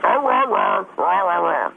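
mock mouthful gibberish; garbled mumbling. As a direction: mimic unclear speech with a full mouth. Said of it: "And this is what you sound like".

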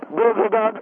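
put-on loud high baby babble; baby talk. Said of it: "baby talk tour".

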